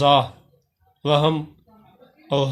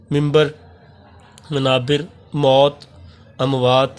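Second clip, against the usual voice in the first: slow, clear reading of each Urdu word with pauses; careful word drill; slower than usual. Shown as a demonstration. Teacher reading plural forms to class, careful drill. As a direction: read each word crisply for students to copy.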